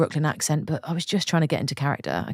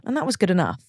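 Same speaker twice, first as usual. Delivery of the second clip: normal talking voice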